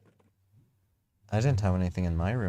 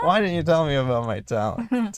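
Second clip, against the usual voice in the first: higher, louder reread of the line; louder retake; 8 semitones higher, 8 dB louder than usual. Acted. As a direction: say it up loud and clear.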